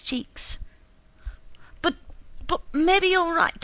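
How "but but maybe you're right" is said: higher, louder faltering voice; tearful, faltering concession. A narrator's reading. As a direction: falter through tears. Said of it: "he faltered".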